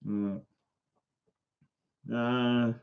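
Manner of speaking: normal talking voice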